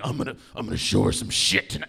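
low, fast, swaggering bro voice; imitating a cocky guy planning rough sex; macho guy. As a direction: brag like a cocky dude. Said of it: "the kind of guy who was like".

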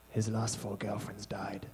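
hushed suspicious whisper; whispered neighbour gossip. Acted. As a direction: lean in and whisper the rumour.

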